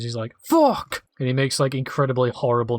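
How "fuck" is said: sudden high angry yelp; obnoxious yell. Retold or imitated. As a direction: blurt it out like a jerk. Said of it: "he's so obnoxious".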